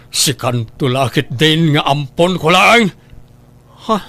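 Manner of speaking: ordinary talk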